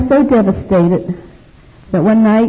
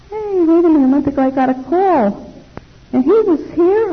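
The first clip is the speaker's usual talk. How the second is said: much higher, stunned retold question; shocked disbelief; 10 semitones higher than usual. Woman told her brother came, high shocked disbelief. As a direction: hear the news and can't believe it.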